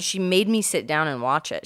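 normal talking voice

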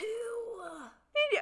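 high breathy squeal of excitement; excited squeal. Real, not acted. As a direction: squeal the words in delight.

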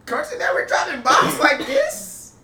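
loud, high, slow amazed shout. Retold or imitated. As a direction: yell it like your mind is blown. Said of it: "I was like".

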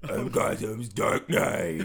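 loud, slow throaty vigilante; Batman. Acted. Guest boasting to the host, loud Batman voice. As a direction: force it out in a gruff rasp. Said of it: "clear your throat".

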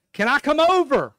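high, loud eager question; stall neighbor's voice. Retold or imitated. Man in next stall seems to invite himself over, high loud voice. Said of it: "the guy in the stall next to me says".

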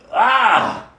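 sudden loud cry of emotion; a short emotional shout; overwhelmed cry. Real, not acted. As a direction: let out a burst of feeling. Overcome by the memory.

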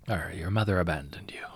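lightly voiced pirate with an Arr; a hint of old sea captain. A narrator's reading. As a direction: answer as a pirate father. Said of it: "he says".

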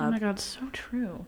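whisper. Real, not acted. Whispered aside on podcast.

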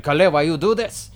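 high, loud comic yell as Mo. Acted. Mo tangled in rope with Kale, comic yelling. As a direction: yell it like a squabbling stooge. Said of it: "you hear Mo just yelling".

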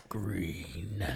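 low, soft growl. Acted. Friend plays the poop tracker in the jungle, growling low. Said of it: "And he growls".

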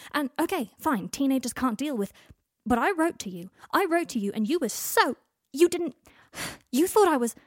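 heated, pleading. Acted. Harry arguing heatedly.

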